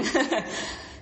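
a short breathy laugh, no voice; a quick real laugh at her own point. Real, not acted.

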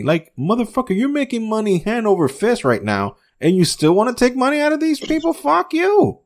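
high-pitched outrage climbing to the last curse; indignant tirade. Acted. Host tells off greedy company for taking money, indignant. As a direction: rant in a rising, incredulous high voice.

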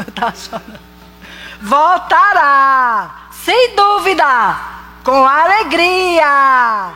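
slow, measured psalm reading; psalm recitation. Acted. Preacher reciting Psalm 126 slowly to the church. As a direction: draw out each phrase like scripture.

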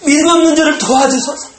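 high, loud pleading cry; desperate shouted prayer. Acted. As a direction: shout the plea up to heaven. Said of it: "소리를 쳤습니다".

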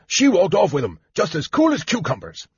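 a hint of a sulky market seller; accusing vendor. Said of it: "accused the fruit vendor".

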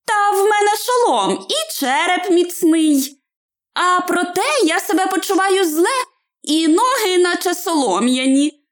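high little hobbit voice; Bilbo, ailing. Acted. Hobbit tells rescuer he feels poorly, high complaint. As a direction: complain mildly, high-pitched. Bilbo describes his wound.

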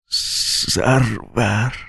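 soft, very slow, dying whisper. A narrator's reading. Dying prisoner whispers to the executioner, soft slow whisper. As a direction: breathe the word out as life fades. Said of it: "به زمزمه گفت".

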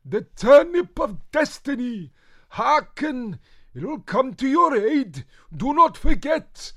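high, slow, loud shriek of a vision; shrieking witch. Acted. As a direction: cry out a prophecy. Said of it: "suddenly shrieked".

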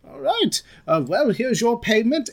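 high, loud innkeeper handing over pay; Judy. Acted. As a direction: pitch it way up as the tavern keeper. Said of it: "and she slaps down on the counter".

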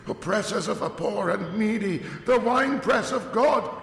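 high, hushed, rising into frantic cries; a mad preacher lamenting his sins, climbing high; raving curate. Acted. Curate raving aloud to God in the dark, high frenzied lament. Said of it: "he would say over and over again".

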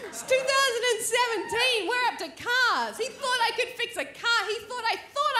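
high, incredulous exclamation; exasperated outburst. Real, not acted. Marvelling to the crowd at his assumption, high and incredulous. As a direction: hammer the absurdity home.

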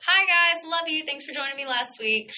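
high, sing-song hello to friends; sweet high-pitched greeting; cheerful shout-out. Pretend. Vlogger greeting friends who joined last week, cheerful shout-out.

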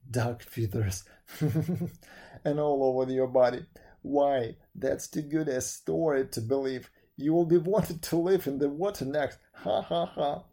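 loud hearty laughter through the words; laughing mockery. A narrator's reading. Lin roars with laughter at Wen's duck-feather story, mocking. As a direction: scoff in disbelief, breaking into ha-ha laughs. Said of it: "a loud laugh, which finally burst into a roar".

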